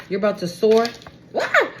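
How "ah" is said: sudden high startled yelp; startled cry. Real, not acted. Reader yelping at a card. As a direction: jolt at what just came up.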